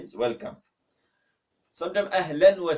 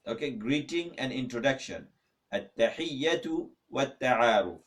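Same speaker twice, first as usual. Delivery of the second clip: ordinary delivery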